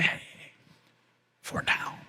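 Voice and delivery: fully unvoiced, tender whisper. Shown as a demonstration. Jesus whispering to someone in their darkest moment, gentle whisper. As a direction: breathe it like a secret comfort. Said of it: "whisper to you and say".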